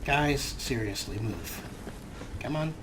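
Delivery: ordinary delivery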